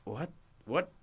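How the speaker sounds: lightly acted confusion on waking; halting, as if just coming to; groggy waking driver. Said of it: "shakes awake and goes".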